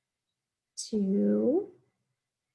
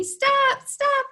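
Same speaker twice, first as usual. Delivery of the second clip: much higher, louder mock shout of stop; kids telling stop; 14 semitones higher, 6 dB louder than usual. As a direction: shout stop back as the class would. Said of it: "I hear you telling me".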